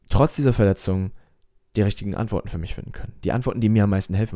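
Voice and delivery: normal talking voice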